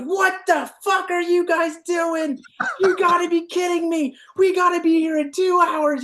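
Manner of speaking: going nuts. Retold or imitated. Timmons comes out to find bloody handlers and dead pigs, going nuts. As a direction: imitate a boss losing it in a high yell. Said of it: "going nuts".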